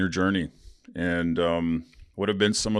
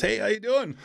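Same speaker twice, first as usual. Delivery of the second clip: much higher-pitched lady's hello; cheerful greeting; 14 semitones higher than usual. Retold or imitated. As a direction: greet brightly as the lady at the door, pitched way up. Voicing lady answering the door.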